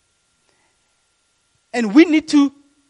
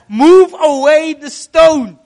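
commanding Jesus; 4 semitones higher, 10 dB louder, slower than usual. Acted.

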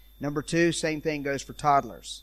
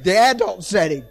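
loud, high "The adult setting" with relish; gleeful shouted choice; mock eager parent; 4 semitones higher, 13 dB louder than usual. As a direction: blurt it out like a fed-up parent. Parent picks taser setting.

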